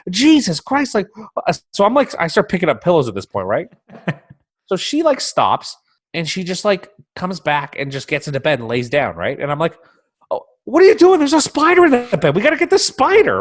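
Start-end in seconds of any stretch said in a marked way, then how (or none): 0.1-1.0 high, loud exasperated exclamation; fed up and alarmed at once. Retold or imitated. Husband reacting to wife's spider scare, loud exasperation. 10.3-13.4 very high, loud panicked pleading; outraged she's going back to sleep with a spider; panicked disbelief. Retold or imitated. Husband pleading with wife to catch the spider, high and loud.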